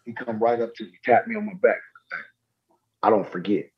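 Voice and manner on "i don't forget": low, understated warning voice; Kobe. Retold or imitated. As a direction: say it low and measured, lightly in character. Retelling Kobe's terse warning to him.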